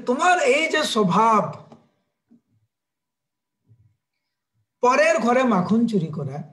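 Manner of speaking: mother telling off her son in anger; angry mother. Acted. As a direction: demand answers from a misbehaving child. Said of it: "মা যশোদা রেগে রেগে বলছে".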